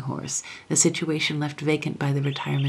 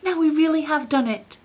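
put-on high, thrilled boyish exclamation; excited boy; 12 semitones higher, 4 dB louder than usual. A narrator's reading. Lionel rejoicing to the hippogriff, high thrilled voice. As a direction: burst out with triumph. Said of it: "he cried".

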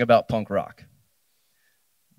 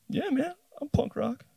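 high, small, quieter voice faking confidence; squeaky timid bluff; 6 dB quieter than usual. Retold or imitated. Freshman bluffing the punk rockers, high timid squeak.

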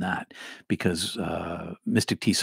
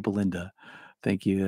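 ordinary talk, nothing marked

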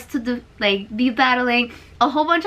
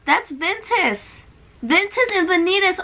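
ordinary delivery

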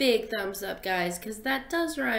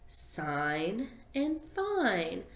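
slow demo; 2 semitones lower, 4 dB quieter, much slower than usual. Shown as a demonstration. Teacher repeating the word pair slowly for kids. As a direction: sign and fine drawn out very slowly. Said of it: "Do sign and fine rhyme?".

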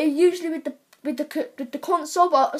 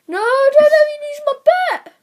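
loud wail of protest; 12 semitones higher, 8 dB louder than usual. Real, not acted. Player pleading with the pack over a bet, high wail. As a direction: cry out as the bet slips away. About to lose own bet.

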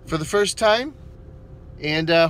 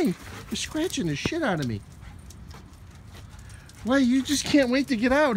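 ordinary talk, nothing marked

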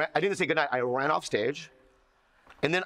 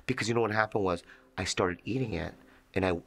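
usual speaking voice

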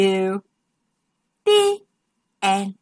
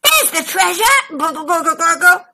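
gurgled; 3 semitones higher, faster than usual. Acted. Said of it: "gurgled Crazy Macy".

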